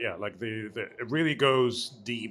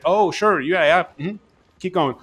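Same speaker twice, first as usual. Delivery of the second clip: higher, louder sarcastic brush-off; mock dismissive; 5 semitones higher, 6 dB louder than usual. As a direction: humor them without listening at all. Brushing off the important message.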